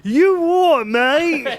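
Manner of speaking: mock British accent. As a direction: lampoon a Brit at the pub. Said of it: "that's my impression of, like, a Brit".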